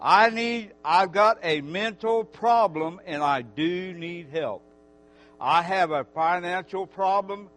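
loud, fervent preacher voice; preaching. Acted. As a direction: preach it out to the whole church. Preaching to the congregation.

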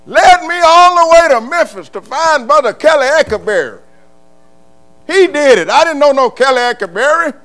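loud, high shouting preacher; preaching at full force. Real, not acted. Preacher shouting to his church about God's leading. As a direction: let it ring out over the congregation.

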